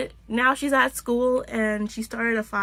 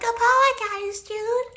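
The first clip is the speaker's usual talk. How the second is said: mock high demand; 13 semitones higher, 3 dB louder than usual. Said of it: "Tyrion is just like".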